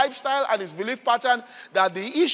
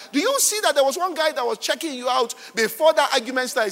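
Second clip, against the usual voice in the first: usual speaking voice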